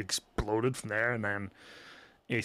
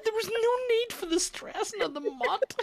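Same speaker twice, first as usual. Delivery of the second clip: high, squeaky words through laughter; laughing hard. Real, not acted. Teasing a friend about the odd stress, laughing hard. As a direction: gasp it out between laughs.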